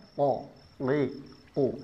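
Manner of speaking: "Ko, ki, ku" as q, with no breath released; qaf jahr. Shown as a demonstration. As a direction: hold back all air on "Ko, ki, ku". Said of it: "tanpa ada nafas yang keluar". ("Ko, ki, ku" tanpa nafas keluar)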